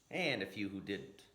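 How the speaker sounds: usual speaking voice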